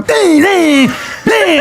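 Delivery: high sing-song ding calls; mock bell dings. Man taunts heckler before fight: high mock bell dings. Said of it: "I turn my body and I'm going".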